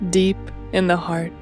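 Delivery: plain reading voice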